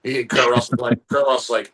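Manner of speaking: usual speaking voice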